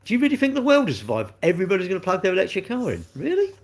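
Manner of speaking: rising high and mocking on really; incredulous disbelief. Real, not acted. Mocking the grid blowing up.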